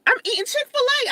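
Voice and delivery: high, pointed reply; defiant. Real, not acted. Vlogger firing back at gawkers, high and defiant. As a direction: tell them off.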